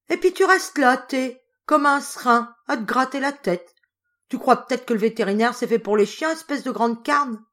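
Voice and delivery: loud, high furious farmer's wife; angry shouting. A narrator's reading. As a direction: scold him at the top of her voice. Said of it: "elle cria".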